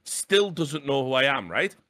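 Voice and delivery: ordinary talk, nothing marked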